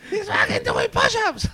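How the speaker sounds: laughing mock. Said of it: "mocks impression as push-ups".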